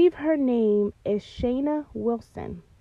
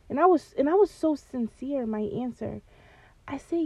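ordinary talk, nothing marked

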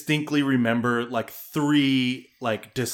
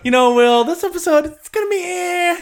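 much higher, loud mock falsetto; 5 dB louder than usual. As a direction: squeak out a weak promise. Mock announcing a bad episode.

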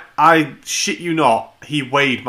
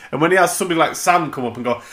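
ordinary talk, nothing marked

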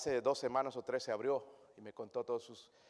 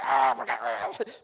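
mock yell; 3 semitones lower, 8 dB louder, much slower than usual. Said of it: "tengo que ir al cuarto, gritar".

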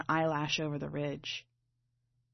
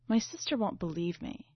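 ordinary delivery, nothing marked

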